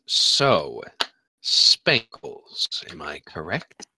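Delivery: low, hushed sinister priestess. Acted. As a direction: ask it low and cold like a sea witch. DM voices priestess.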